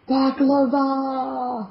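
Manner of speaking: drawn-out, high, loud call; excited exclamation. Pretend. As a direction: belt out the word slowly with relish. Excited cry for a dessert.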